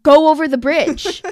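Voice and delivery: loud, slow exasperated order; retold emphatic outburst. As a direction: spell it out loudly, fed up. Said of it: "I was raising my voice".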